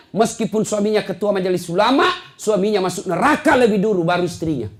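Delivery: high, loud fiery sermon; full-force preaching. Real, not acted. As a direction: preach it hard to the mothers.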